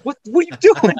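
high, loud startled outburst; mock shock. Masseuse walking in on a naked client, mock shocked. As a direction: blurt it out in exaggerated alarm. Shocked therapist walks in on him.

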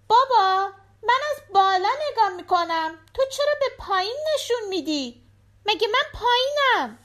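put-on high, eager child's voice; little girl voice. A narrator's reading. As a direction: play the small daughter at the door. Daughter voiced.

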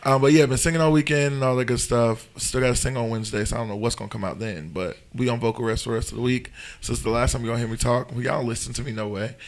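hoarse and soft; talking through a lost voice; raspy whisper. Real, not acted. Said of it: "I have no voice at all".

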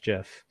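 "gif" said as jiff; soft g. Shown as a demonstration. Restating inventor's preference, soft-g gif. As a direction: open "gif" with a j sound. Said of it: "should be pronounced gif".

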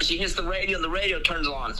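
ordinary talk, nothing marked

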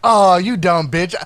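plain reading voice

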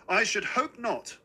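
mother's sharp, high-pitched rebuke; haughty, pitched well up. A narrator's reading. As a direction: cut him down with high, indignant disapproval. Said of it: "embarrassed by my mother's sharpness".

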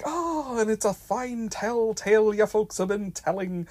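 high lilting stage-Irish brogue; hammed-up leprechaun accent. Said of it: "he sounds like Irish leprechaun".

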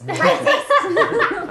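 loud, high-pitched goblin complaint; grumbled accusation. Acted. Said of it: "grumbling under my breath".

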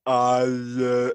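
loud dopey dog grunt; idiotic dog. Pretend. Idiotic dog answering its owner, dopey and loud. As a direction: play a dog too dumb for words. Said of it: "your dog was completely idiotic".

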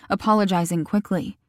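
ordinary delivery

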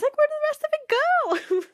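high outraged where did it go; incredulous squeal. Retold or imitated. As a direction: squeal at the missing lines. Reacting to missing prophecy.

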